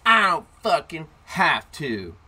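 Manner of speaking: slow, loud, high defiance; mock bratty shout; imitated kid yelling across. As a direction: holler back defiantly from across the park. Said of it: "he from across the way is like".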